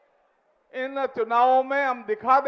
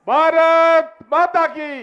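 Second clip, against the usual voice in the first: very slow, much louder rally chant; louder slogan call; 6 semitones higher, 9 dB louder, much slower than usual. Real, not acted. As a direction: stretch the call out and belt it. Repeated slogan call, bigger.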